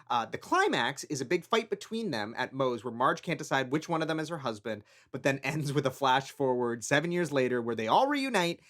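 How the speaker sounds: quick recorded aside; a soft recorded note; podcast insert read. Real, not acted.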